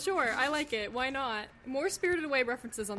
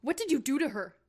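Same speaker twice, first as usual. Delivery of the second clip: louder, angry demand; shaking with anger; 3 semitones higher, 5 dB louder than usual. Acted. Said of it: "his arms shaking with anger".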